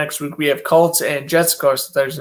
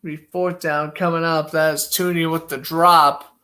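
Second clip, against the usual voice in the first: brisk broadcaster's call of each play; live play-by-play. Acted. Calling the football game live for stream viewers, play-by-play announcer voice. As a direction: call the snap and throw like a TV announcer.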